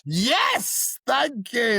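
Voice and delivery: high, loud happy thanks; delighted. Real, not acted. Guest thrilled by the host's compliment, high and loud. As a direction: take the compliment with glee.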